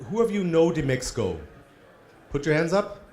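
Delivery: usual speaking voice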